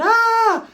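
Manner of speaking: high mock shriek of a girl; the girl screams as the fight starts, high shriek; retold scream. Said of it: "The chick screaming".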